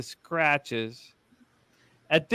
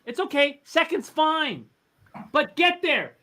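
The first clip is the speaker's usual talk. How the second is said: yelling at a horse race; 11 semitones higher than usual. Real, not acted. Urging horse near finish.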